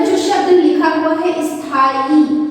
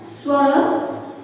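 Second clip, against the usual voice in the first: slow, softer word for dictation; 5 dB quieter, slower than usual. Shown as a demonstration. Teacher dictating the answer word, slowly and softly. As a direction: draw it out so they can write. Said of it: "क्या होता है?".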